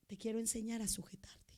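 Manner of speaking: soft, breathy whisper; whispered divine voice. Acted. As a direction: murmur it tenderly like a secret. Voicing the Lord speaking softly.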